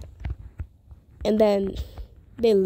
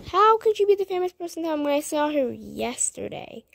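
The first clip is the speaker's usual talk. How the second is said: mock indignant; 6 semitones higher than usual. Retorting to classmates' taunts.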